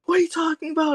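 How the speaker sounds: very high-pitched mock alarm; imitated parental freak-out. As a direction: play panicked parents, voice shooting way up. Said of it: "freaking out".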